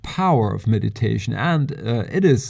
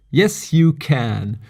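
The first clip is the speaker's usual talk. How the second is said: YES, YOU CAN spaced out slowly and deliberately; slow, emphatic "yes, you can" in playful campaign style; campaign slogan; slower than usual. Pretend. Slogan delivered like a campaign line.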